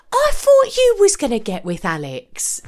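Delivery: put-on voice, blurting it out; Maddie. Butting in to heartbroken Matt, loud tactless blurt. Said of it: "Maddie wonderfully turns to Matt".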